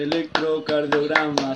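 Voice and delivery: "Electrocardiograma" slow, low and soft; half to yourself, measuring out "Electrocardiograma"; syllable counting. Shown as a demonstration. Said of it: "¿Siete?".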